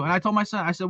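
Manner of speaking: usual speaking voice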